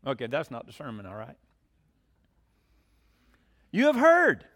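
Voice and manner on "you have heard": loud, high and ringing, falling off the word heard; booming emphasis. Real, not acted. Preacher announcing the scripture to the congregation, booming. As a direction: proclaim You have heard out to the whole room.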